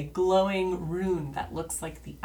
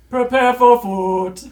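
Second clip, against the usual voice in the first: very loud, higher, slow sung-out boom; giant alien booming voice; 7 semitones higher, 17 dB louder, slower than usual. Acted. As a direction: boom it out, half singing, trying not to laugh. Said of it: "there is a booming voice".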